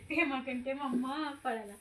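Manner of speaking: laughing through the words. Real, not acted. Mother laughing while she talks.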